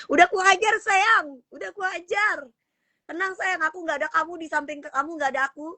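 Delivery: high-pitched, mock-pleading lovey talk; playful sweetheart reassurance. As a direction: play-act soothing a worried sweetheart. (rengekan manja pura-pura menenangkan pacar)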